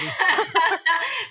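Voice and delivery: a bright, rising burst of laughter; real laugh. Real, not acted. Guest amused by the host calling her baby, laughing aloud. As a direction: laugh out loud at the cheeky joke.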